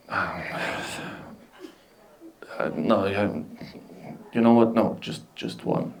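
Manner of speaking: soft, breathless, unvoiced reply; sad whisper. After a breakup, ordering one orange, whispered sadly. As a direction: whisper it, heartbroken. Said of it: "remembering about my breakup".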